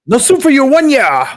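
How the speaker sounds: high, loud mock-banishment shout; mock stern catchphrase. Streamer jokingly banishing someone from soup, hammed-up high shout.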